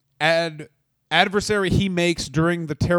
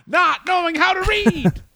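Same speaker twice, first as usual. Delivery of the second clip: laughing shout; 12 semitones higher than usual. Acted. Character laughs and shouts high.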